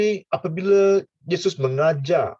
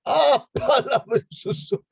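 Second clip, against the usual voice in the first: slow, high, laughing disbelief; incredulous giggly what, milk?; laughing mock question; 10 semitones higher, slower than usual. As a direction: ask it through a laugh, much higher.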